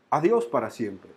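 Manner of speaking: low, soft, heavy goodbye; sorrowful farewell. Retold or imitated. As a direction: let the last words sink away quietly. Father's final farewell to son.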